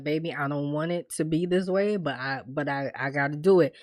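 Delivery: low, soft, halting regret; retold tearful apology. Man apologizing to his girl, quietly sorrowful. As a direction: imitate a reluctant man, understated sorrow. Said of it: "and he's crying".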